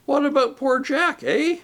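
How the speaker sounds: rising, indignant jab at the name Jack; indignant question. A narrator's reading. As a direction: needle him about Jack. Voices the big ghost.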